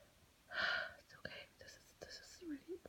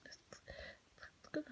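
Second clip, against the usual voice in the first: hushed, anxious whisper to herself; 10 dB louder than usual. Acted. Student at her desk whispering pep talk to herself, anxious whisper. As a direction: keep it breathy and secret, talking yourself through it.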